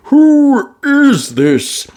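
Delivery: very slow groggy question; drowsy half-awake voice; sleepy. Acted. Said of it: "said Daddy Pig sleepily".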